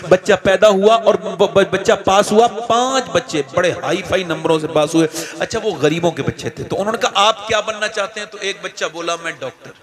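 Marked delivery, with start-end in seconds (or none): 2.5-9.7 hushed, gentle sermon story voice; soft storytelling. A narrator's reading. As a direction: draw them in softly. (धीमी आवाज़ में कहानी सुनाना)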